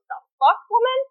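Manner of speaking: high-pitched mock indignation; incredulous retort, jumping up in pitch; scandalised refusal. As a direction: imitate a man appalled by the suggestion. Voices man refusing scandalised invitation.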